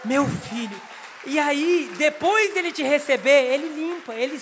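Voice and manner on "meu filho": meu filho said louder, with weight; God calling his child, louder; emphatic. Shown as a demonstration. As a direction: repeat meu filho with fuller voice. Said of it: "Ele fala".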